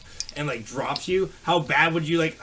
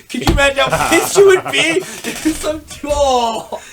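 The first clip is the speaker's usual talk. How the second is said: much higher and louder, laughing indignation; worked-up outrage; 11 semitones higher, 10 dB louder than usual. Real, not acted. Telling his friend about a stranger pissing on his foot, loud laughing outrage. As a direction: get heated at the very thought of it. Imagining being pissed on.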